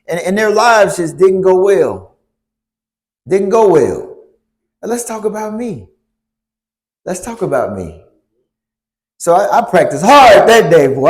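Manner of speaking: ordinary talk, nothing marked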